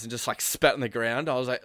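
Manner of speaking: usual speaking voice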